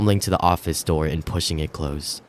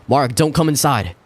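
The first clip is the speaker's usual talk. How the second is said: louder, higher warning cry; yelling; 7 semitones higher, 3 dB louder than usual. Acted. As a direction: shout to keep him out. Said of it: "Rachel yelled".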